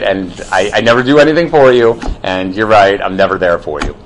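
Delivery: low, sarcastic agreement; mock agreeing, dripping sarcasm; sarcastic God. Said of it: "that's sarcasm".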